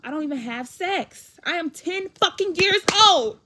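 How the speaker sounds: building to a high, full shout; outraged outburst. Retold or imitated. Ten-year-old protesting dad's pregnancy remark, outraged shouting.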